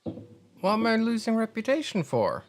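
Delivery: high-pitched indignant disbelief; baffled protest. Real, not acted. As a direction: protest in a high, incredulous whine. Streamer protests game's reputation penalty.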